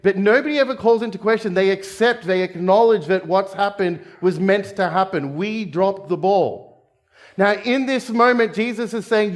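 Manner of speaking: ordinary talk, nothing marked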